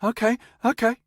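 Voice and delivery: high, loud pleading backpedal; grovel, suddenly desperate to live; obsequious and pathetic. Acted. Cornered man caving in at gunpoint, high and pleading. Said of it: "switching from gloatingly dominant to obsequious and pathetic".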